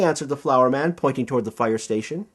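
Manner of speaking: ordinary delivery, nothing marked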